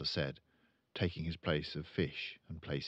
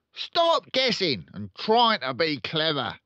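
blustering, slow, high car-dealer dad; pompous father voice; loud pompous father; 11 semitones higher, 10 dB louder than usual. Acted. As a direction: boom it out like a showy salesman.

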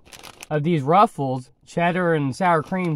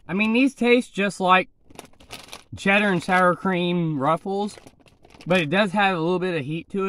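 chewing while talking; muffled chewy speech; mouth full of chips; 2 semitones higher than usual. Real, not acted. Seen 0: Eats chip just before; jaw and lips show chewing during speech.

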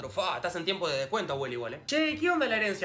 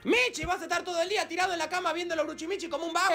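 higher scolding voice; nagging owner; 7 semitones higher than usual. Acted. Owner nagging the lazy cat, higher. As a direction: nag the cat from the door.

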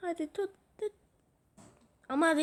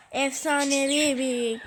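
normal talking voice

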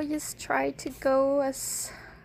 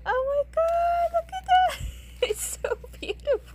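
loud, high giggles of delight; giggling with joy; 8 semitones higher, 7 dB louder, faster than usual. Real, not acted. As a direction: laugh through it, too thrilled to stop. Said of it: "I can't help but laugh".

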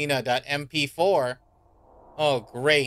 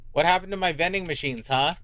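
ordinary delivery

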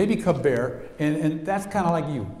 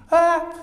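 put-on high, louder child-like yelp; a frightened little cub crying out; cub's cry; 9 dB louder than usual. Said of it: "little children's sounds for the bear".

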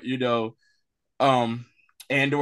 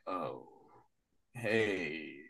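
quiet low greeting; 12 semitones lower, 14 dB quieter than usual. Retold or imitated. Robot voiced timidly.

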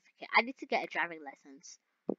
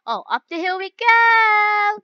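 loud, high, drawn-out cheer; excited whoop; 12 semitones higher, 17 dB louder, slower than usual. Real, not acted. Driver as the car goes up the hill, excited whoop. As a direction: ride it up into a long high yell.

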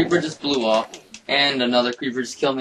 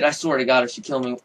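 normal talking voice

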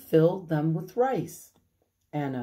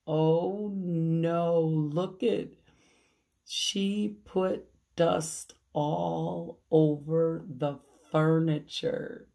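plain reading voice

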